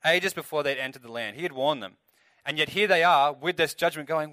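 ordinary talk, nothing marked

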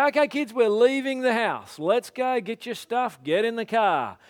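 put-on high, loud parent rounding up the kids; parent calling kids. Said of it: "Like, I say".

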